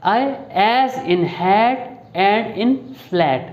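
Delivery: very slow, each word drawn out for the vowel in hat; slow vowel demo. Shown as a demonstration. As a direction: spell out the a sound slowly like a teacher. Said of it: "अब देखें हमारे पास जो सबसे पहला vowel आ रहा है ए".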